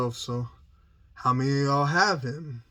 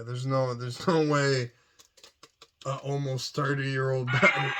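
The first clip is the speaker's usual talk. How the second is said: ordinary delivery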